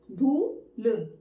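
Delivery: धूल very slowly as long धू, then ल; split in two sounds. Shown as a demonstration. Teacher counting letters for writing, धूल split slowly. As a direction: break धूल into धू and ल. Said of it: "दो word".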